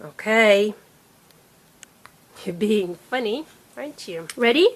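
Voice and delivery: usual speaking voice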